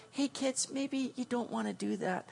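put-on soft, timid hush to the kids; mock whisper. Parent timidly whispering a warning to kids. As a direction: whisper it, too polite to scold. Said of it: "I'll just maybe whisper".

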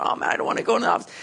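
fast, mock-nervous whisper; anxious whisper. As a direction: mutter your dread quickly under your breath. You're going.

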